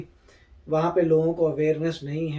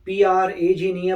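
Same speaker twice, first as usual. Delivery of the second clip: usual speaking voice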